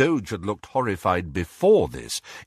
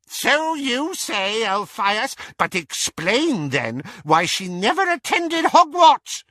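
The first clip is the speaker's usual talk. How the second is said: high, insistent aunt retort; Auntie Muriel, challenging; 13 semitones higher, 4 dB louder than usual. A narrator's reading. Auntie Muriel challenging Elphias, high and scornful.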